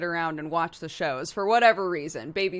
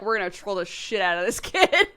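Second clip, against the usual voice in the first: loud line breaking into laughter; mock scheming, loud and laughing; retold mischief; 6 dB louder than usual. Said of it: "They're like".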